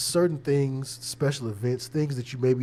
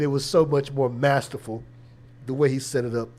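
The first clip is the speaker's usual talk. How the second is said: usual speaking voice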